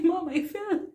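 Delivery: high, breathless giggling report; laughing. Retold or imitated. Calling her mother after the fall, laughing. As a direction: tell mom through giggles. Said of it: "I couldn't stop laughing".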